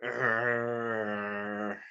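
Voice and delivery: low playful growl for a mad face; hammed-up mad-face growl; mock angry growl. Shown as a demonstration. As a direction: growl like a pretend grumpy bear for the kids. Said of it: "So show me your mad face".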